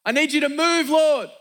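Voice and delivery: high, loud plea to God; desperate prayer. Acted. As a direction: cry out to God from your knees, building. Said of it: "we're on our knees".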